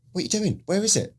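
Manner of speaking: high-pitched retold protest; alarmed questioning. Acts out questioning the medic.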